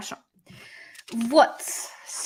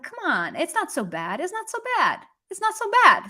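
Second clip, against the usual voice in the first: "not so bad" swooping high, then falling; coaxing intonation; 8 semitones higher than usual. Shown as a demonstration. Teacher demonstrating an intonation that stands in for уж, coaxing lilt. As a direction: coax with "come on", lifting "it's not so bad" higher on each repeat. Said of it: "See how I'm doing that intonation".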